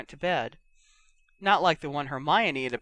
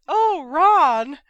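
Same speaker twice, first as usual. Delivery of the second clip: loud, mock-swooning falsetto; high-pitched cry; 11 semitones higher, 10 dB louder than usual. A narrator's reading. George mocking his brother's admirer, high-pitched cry. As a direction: squeal it in mock rapture. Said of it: "in a high-pitched voice".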